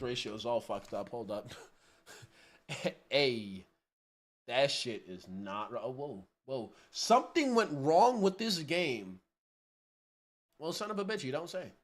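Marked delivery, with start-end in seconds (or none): none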